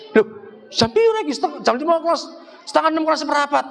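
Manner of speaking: high, startled "how late is it"; mock surprise. Husband realising prayer time is almost gone, mock startled. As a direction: jump up in pitch, caught off guard by the hour.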